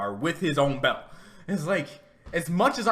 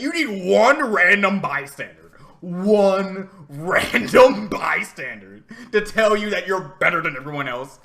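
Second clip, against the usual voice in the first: louder, drawn-out outrage at the plot; loud exasperated rant; 7 dB louder than usual. Real, not acted. Fan ranting to viewers about the plot, loud and drawn out. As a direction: spell it out slowly, fed up. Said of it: "emphatic rant".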